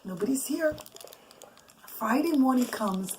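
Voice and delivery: ordinary delivery, nothing marked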